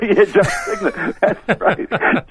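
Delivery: high-pitched, laughing agreement. Real, not acted. Guest tickled by host's joke about John Cigna, laughing. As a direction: agree through real laughter.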